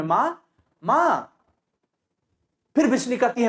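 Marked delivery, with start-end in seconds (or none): 0.0-1.3 slow, anxious calling; a scared daughter's call; frightened. Acted. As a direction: daughter waking in alarm, calling her mother. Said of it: "घबराए हुए स्वर में".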